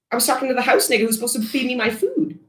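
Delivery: high, fast snooty put-on voice; mock entitled Karen. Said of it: "you're going".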